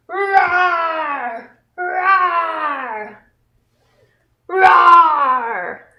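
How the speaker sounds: put-on a playful roar for children; tiger roar. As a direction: growl it out like a friendly tiger. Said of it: "pretend to be a tiger".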